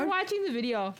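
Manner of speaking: talking with an underbite; overbite. Real, not acted. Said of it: "Stop messing with my mouth".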